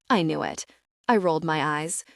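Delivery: ordinary delivery, nothing marked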